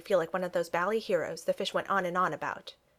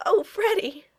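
high, loud, choked exclamation; 13 semitones higher, 6 dB louder, slower than usual. A narrator's reading. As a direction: burst out high, stifling a giggle. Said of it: "she uttered in a choked voice".

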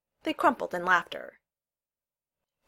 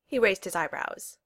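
usual speaking voice

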